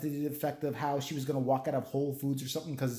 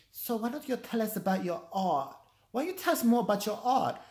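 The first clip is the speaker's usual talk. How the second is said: higher, mock-friendly interviewer voice; radio host; 7 semitones higher than usual. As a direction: imitate the college radio host asking about art. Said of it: "and I hear".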